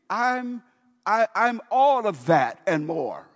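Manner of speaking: loud, high, slow brag; boastful shout. Acted. Preacher as the demoniac boasting to Jesus, loud high brag. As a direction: swell up and brag it out.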